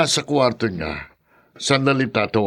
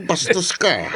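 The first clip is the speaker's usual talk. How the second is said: laughing rebuke; 5 semitones higher than usual. Acted. Laughing at friend's teasing.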